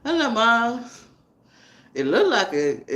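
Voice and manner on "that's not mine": whiny self. Retold or imitated. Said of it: "I was like".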